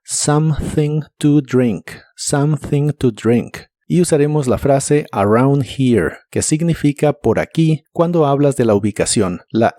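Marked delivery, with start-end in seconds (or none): 0.0-3.6 "Something to drink" slowly, u-like o and blown th between tongue and teeth; "something" soplando la th; slow th demo. Shown as a demonstration. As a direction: blow air through the th of "Something to drink". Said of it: "la T H se pronuncia soplando".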